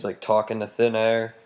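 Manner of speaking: usual speaking voice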